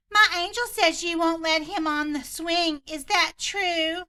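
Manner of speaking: hammed-up entitled mom, slow and high; entitled Karen.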